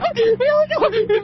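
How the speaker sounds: high laughing burst; burst of laughter. Real, not acted. Rider laughing at his friend's pass, high laugh.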